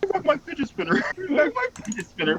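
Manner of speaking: high, quick cartoon character voice; Wilt. Friend playing Wilt pestering Blue, high quick voice. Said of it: "Wilt's catchphase".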